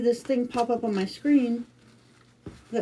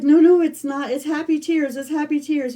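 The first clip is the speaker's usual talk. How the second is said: higher, insistent retold reassurance, louder at the start; retold emotional reassurance; 7 semitones higher, 7 dB louder than usual. Wife tells husband on the phone it's happy tears, retold emotional reassurance. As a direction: rush in high to head off the worry, as retold.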